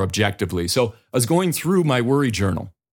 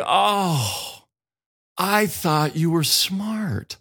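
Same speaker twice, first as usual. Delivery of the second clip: disappointed listener; 7 semitones higher, much slower than usual. Retold or imitated. Audience disappointed by his plain voice.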